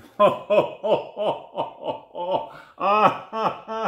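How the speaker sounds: long, high villain cackle; staged evil laugh in repeated bursts; manic shark laugh. Acted. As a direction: cackle like a gleeful cartoon shark. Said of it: "his laughter was manic".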